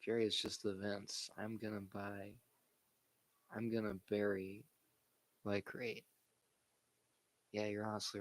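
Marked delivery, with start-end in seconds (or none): none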